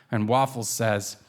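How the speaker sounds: ordinary talk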